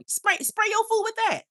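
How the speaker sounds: a high, giddy push to spray the food; excited urging. Real, not acted. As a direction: pitch it way up, egging them on. Excited advice.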